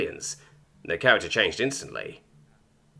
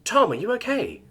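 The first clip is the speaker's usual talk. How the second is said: high, frantic worried question; 13 semitones higher than usual. Acted. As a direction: ask in a panic. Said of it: "she asked frantically".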